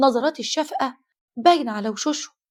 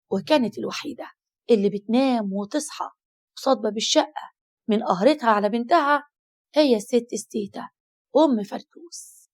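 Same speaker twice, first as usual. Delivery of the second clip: usual speaking voice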